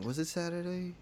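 quietly asking himself, half under his breath; soft, trailing self-question; muttering to himself. Real, not acted. Wondering aloud to himself.

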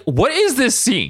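high, drawn-out disbelief; exasperated bafflement. Retold or imitated. Host reacting to the Apocalypse scene, exasperated. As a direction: stretch it high in mock exasperation.

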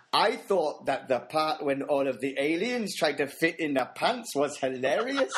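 slow, amateur Geordie; attempted Geordie accent. Podcaster reading a child's book review, attempted Geordie accent. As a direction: read it in a mock Geordie accent. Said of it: "we're gonna try Geordie accent".

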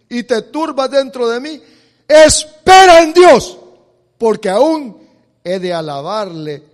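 loud, ringing sermon delivery; fiery preaching. Acted. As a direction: preach it out to the congregation. Preacher at full force.

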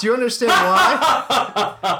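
laughing high and slow. Real, not acted. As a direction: ask it through laughter. Laughing repeated question.

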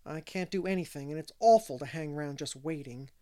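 ordinary delivery

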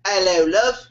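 put-on playful British accent, amused; put-on British accent with a laugh. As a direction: mimic a British charmer's greeting. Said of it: "You put an accent on".

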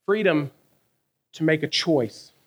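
usual speaking voice

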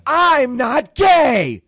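drawn-out, high full-volume shout; Bobby. Retold or imitated. Imitating a castmate shouting his denial before slamming the door, indignant shout. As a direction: bellow the denial slowly in a put-on voice. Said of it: "and he shouts".

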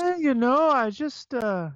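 put-on high-pitched tipsy Canadian accent, trailing off; drunk Canadian accent. Imitating drunk dudes at the bar up north, high Canadian accent. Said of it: "those dudes who are drunk".

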